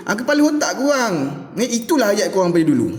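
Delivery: ordinary delivery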